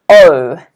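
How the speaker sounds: O said slow and clear; long clear O for the class; model word. Shown as a demonstration. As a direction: pronounce O as a teaching model. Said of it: "Can everyone repeat this at home?".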